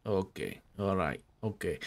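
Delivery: usual speaking voice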